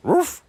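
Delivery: high, loud startled dog yelp; a dog jumps as Polly falls in; startled bark. Acted. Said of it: "siger Olsen forskrækket".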